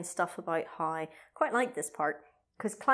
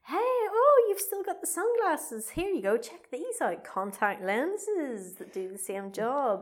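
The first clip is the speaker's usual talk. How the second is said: high chirpy sales pitch, slowing to finish; sweet pushy stall lady; saleswoman voice; 6 semitones higher, slower than usual. Retold or imitated. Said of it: "ladies behind various tables going".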